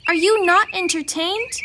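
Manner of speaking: mock-epic taunt to the spectators; gladiator taunt. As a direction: roar it at the crowd. Victorious rock taunts crowd.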